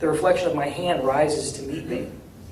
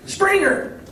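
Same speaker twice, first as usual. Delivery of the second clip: a sudden high shout of the name; yell; 4 dB louder than usual. Acted. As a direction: call it out from the crowd. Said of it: "someone yells".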